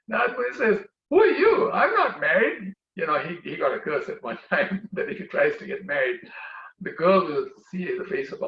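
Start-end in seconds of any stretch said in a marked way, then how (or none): 1.1-2.7 startled denial. Acted. Narad confronted by strange woman.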